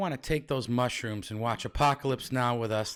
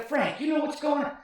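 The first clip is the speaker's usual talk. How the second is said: high mock pleading voice; high silly voice; 12 semitones higher than usual. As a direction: ask it in a high put-on voice. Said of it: "I go".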